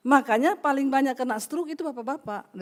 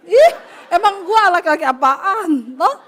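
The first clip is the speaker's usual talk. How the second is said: loud, laughing scoff of disbelief; laughing indignation; 4 semitones higher, 9 dB louder, slower than usual. Retold or imitated. A man mocked by his friend scoffs loudly through laughter. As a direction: laugh it off as absurd, full voice.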